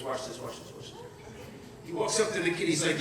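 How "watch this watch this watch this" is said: very low, soft plotting murmur; hushed, low-pitched mischief; conspiratorial aside. Retold or imitated. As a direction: say it under your breath to an accomplice. Father's aside before the prank.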